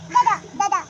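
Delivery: loud, high call. Real, not acted. Mother calling out loudly to her older son. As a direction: call him from afar.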